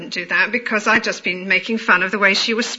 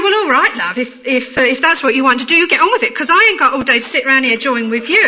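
mock lower-class London, consonants dropped; lower-class London accent. Telling the caller to get on with it, lower-class London accent. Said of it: "I came down to the, to the lower class accent".

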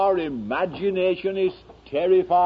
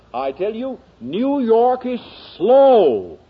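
impassioned preaching; 8 semitones higher than usual. Real, not acted. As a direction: pile up the list in high, punched phrases with dramatic pauses. Preacher builds a rhetorical climax.

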